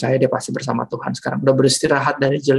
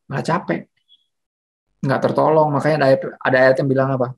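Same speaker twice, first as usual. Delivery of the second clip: ordinary delivery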